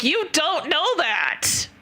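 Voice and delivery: slow, pointed comeback; defiant retort. Retold or imitated. Arguing with the doctor on the phone, slow and defiant. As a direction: drag it out, daring him to argue.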